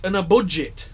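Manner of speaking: ordinary talk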